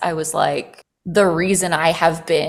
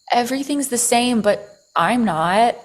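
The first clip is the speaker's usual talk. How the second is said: ordinary delivery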